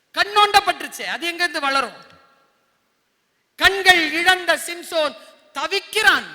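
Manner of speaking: loud, high dramatic storytelling; fiery preaching. A narrator's reading. Preacher tells children of Samson's downfall, forcefully.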